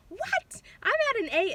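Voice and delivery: very high, loud astonished cry; shocked disbelief. Retold or imitated. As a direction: gasp it out in total disbelief. Shocked at cervix check.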